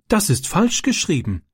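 high, sudden blurt; sudden remark. A narrator's reading. Francis suddenly noticing the misspelled card, blurting it out. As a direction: spot it and blurt it out at once. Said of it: "bemerkte Francis plötzlich".

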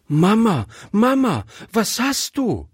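put-on high, worried little girl's call; child calling out. A narrator's reading. Gretchen calls to her trembling mother, child calling out. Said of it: "rief Gretchen".

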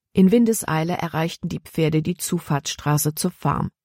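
ordinary delivery

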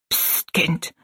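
put-on hissed hush to a child; hushed admonition. A narrator's reading. Little woman warns Rosalie not to wake mother, hushing. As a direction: gently shush the child. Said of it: "mahnte sie der kleine Mensch".

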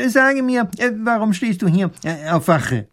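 high-pitched, hesitant interrogation; questioning professor. A narrator's reading. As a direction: question him officiously, with halting fillers.

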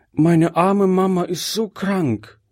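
put-on high, plaintive child's voice; high-voiced child appealing to a grown-up; Gretchen. A narrator's reading. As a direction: plead as a little girl, pitched high. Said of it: "sagte Gretchen zu dem alten Mann".

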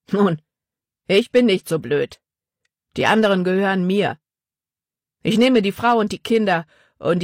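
a bandit grabbing what he can; greedy bandit. A narrator's reading. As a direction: bandit boasting he'll sell the family, brash. Bandit voiced by narrator.

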